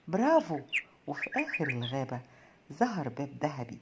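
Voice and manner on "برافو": higher and much louder, bright happy cheer; happy chirp. A narrator's reading. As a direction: cheer like a delighted little bird. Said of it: "العصفورة زقزقت بسعادة".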